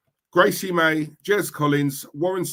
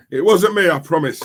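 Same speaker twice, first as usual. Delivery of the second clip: a loud, higher 'It wasn't me', playfully denying; mock protest; 4 semitones higher, 7 dB louder than usual. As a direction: cry out your innocence as a joke. Jokey denial of beating Julian.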